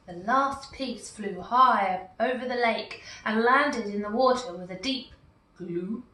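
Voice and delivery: usual speaking voice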